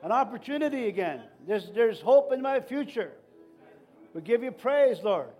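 loud, rising preacher's prayer; fervent prayer. Acted. Pastor praying aloud over his church, loud fervent prayer. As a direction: pray over the people with full force.

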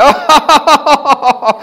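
bursting into a laugh of joy; real laugh. Real, not acted. Preacher laughing aloud before the church. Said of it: "I started laughing".

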